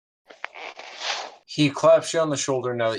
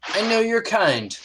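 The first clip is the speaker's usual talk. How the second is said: much higher, gentle sailor voice; kindly first mate; 12 semitones higher than usual. Acted. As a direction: tell him he's kind. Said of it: "and he goes".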